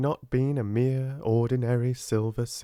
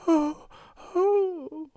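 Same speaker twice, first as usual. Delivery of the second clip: high, woeful exclamations; woeful cry; 3 dB louder than usual. A narrator's reading. Ermengarde learning her friend is hungry, woeful cry. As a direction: cry out in woe. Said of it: "she cried woefully".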